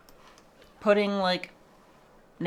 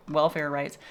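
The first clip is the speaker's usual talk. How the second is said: ordinary talk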